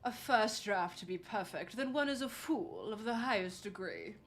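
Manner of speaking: lightly posh British, slow start. Pretend. Streamer doing a redeemed accent for chat, mock posh British. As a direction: put on a mock posh English voice. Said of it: "I feel like I sound like".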